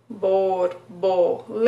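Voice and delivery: "Borboleta" split slowly, one beat per syllable, rising at the end. Shown as a demonstration. Therapist beating out syllables for a child, very slow. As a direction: count out bor-bo-le-ta, very slow. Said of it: "Quantas vezes a gente pode separar".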